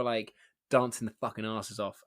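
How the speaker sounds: normal talking voice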